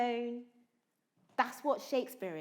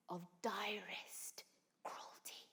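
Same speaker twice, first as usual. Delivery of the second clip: whisper; 4 semitones lower, 12 dB quieter, slower than usual. Acted. Whispered Macbeth invocation.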